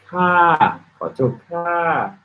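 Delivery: "koto ka" slow, starting high and falling on "ka". Shown as a demonstration. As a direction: slowly drop the pitch on the final "ka". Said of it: "You'd be like".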